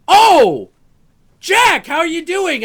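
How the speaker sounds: high, loud delighted greeting; Larry greeting. Retold or imitated. Old artist greeting young fan, high and loud. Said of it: "he goes".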